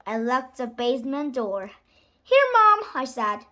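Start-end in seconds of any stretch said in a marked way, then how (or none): 2.2-2.8 high, loud call; calling to Mom. Acted. Child back at the table to Mom, high loud call. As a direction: call out brightly pitched and loud.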